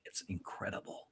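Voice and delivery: hushed whisper aside. Real, not acted. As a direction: breathe it softly.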